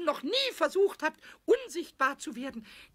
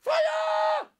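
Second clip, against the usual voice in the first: much higher, loud alarm shout of Feuer; 11 semitones higher, 5 dB louder than usual. Acted. Goblin shouting to startle the carpenter, loud. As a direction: yell out as if raising the alarm. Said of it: "schrie der Pumuckl".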